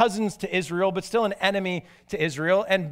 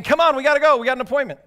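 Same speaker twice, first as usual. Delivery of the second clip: louder, higher hurried urging; impatient urging; 5 semitones higher, 5 dB louder, much faster than usual. Retold or imitated. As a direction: hurry the donkey along, annoyed. Impatient sorcerer whacking his donkey.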